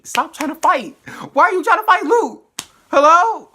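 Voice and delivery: very high, loud playful yelling; exasperated scolding. Real, not acted. Viewer telling Danny to stop fighting Luke, exasperated yell. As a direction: shout in comic disbelief.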